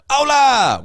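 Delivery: excited greeting. Real, not acted. Welcoming a chat member, laughing.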